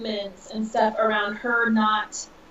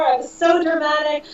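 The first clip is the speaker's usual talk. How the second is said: normal talking voice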